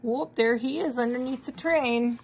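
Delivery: plain reading voice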